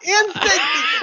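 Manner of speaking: high, loud, drawn-out cheer; mock rallying shout. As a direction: shout it like a joke protest slogan. Gleeful shouted echo of joke.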